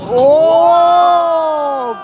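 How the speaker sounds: long, loud, high "oh" of amazement; real thrilled cry; awed whoop. Real, not acted. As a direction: whoop in awe at the ride. Watching coaster's first test run.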